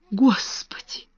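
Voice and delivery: soft, breathy pitiful whisper; plaintive whisper. A narrator's reading. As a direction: gasp it in pity. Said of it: "жалобно проговорила Эрменгарда". (жалобный шёпот)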